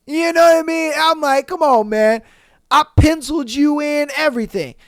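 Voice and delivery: loud, exasperated scolding; annoyed gamer. Retold or imitated. Gamer boyfriend scolding girlfriend for interrupting, loud and annoyed. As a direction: tell her off mid-game. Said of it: "you're like".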